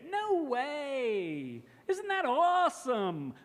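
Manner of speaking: high rise-fall, big excited American surprise; rise-fall on No way and awesome. Shown as a demonstration. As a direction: gush it like you can't believe it. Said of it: "notice the rise, fall of the intonation".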